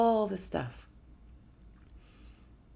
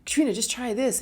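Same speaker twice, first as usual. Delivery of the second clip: urgent, insistent family pleading; imitating worried family nagging; pleading relatives; 5 semitones higher, 4 dB louder than usual. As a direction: press her to try it, raised and pushy. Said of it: "they were like".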